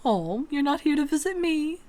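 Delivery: high pouting whine; pouting shopkeeper feigns hurt; mock pout. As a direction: sulk it like a pouting child. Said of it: "He does a mock pout".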